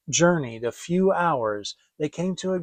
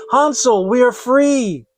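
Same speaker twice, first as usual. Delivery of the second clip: much higher, louder joyful call; joyful cry; 7 semitones higher, 8 dB louder than usual. Acted. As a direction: shout the good news to her brother. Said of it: "and cried".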